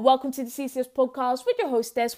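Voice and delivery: usual speaking voice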